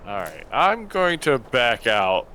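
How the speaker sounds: ordinary talk, nothing marked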